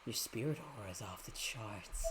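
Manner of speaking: eager whispered villain; whispering villain. Acted. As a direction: hiss it with hunger. Voicing villain in game.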